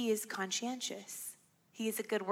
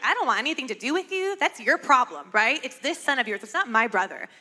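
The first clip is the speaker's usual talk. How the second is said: dismissive; 3 semitones higher, 8 dB louder than usual. Retold or imitated. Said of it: "To me, I'm like".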